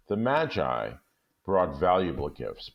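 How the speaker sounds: ordinary delivery, nothing marked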